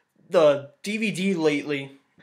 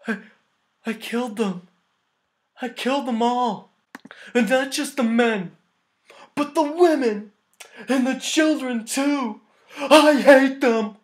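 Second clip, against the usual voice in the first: badly faked crying; 6 semitones higher than usual. Reviewer mimicking Anakin's confession to Padme, badly faked crying. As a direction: overact a tearful confession in slow bursts. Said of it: "he terribly starts crying. It's not even real tears".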